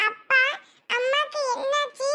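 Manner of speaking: put-on high, small child's voice, worried; little child voice. Little daughter asks her father what happened to mom, in a high child's voice. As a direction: play a small kid asking for mom.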